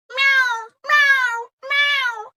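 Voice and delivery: high, loud cat meows; cat meowing. A narrator's reading. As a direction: cry like a hungry cat. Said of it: "But Greedy Cat cried".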